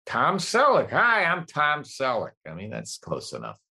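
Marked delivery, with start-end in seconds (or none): 0.1-2.3 a light Tom Selleck, a bit higher; Tom Selleck impression. Shown as a demonstration. Showing the host his Tom Selleck, lightly voiced. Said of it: "you just find the rhythm".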